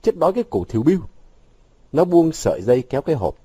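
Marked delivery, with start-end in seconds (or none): 0.0-1.0 higher-pitched cross retort; irritated outburst. A narrator's reading. Vũ rejecting the idea that Vọng starved, irritated. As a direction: snap back, understated annoyance. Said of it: "Vũ cáu tiết".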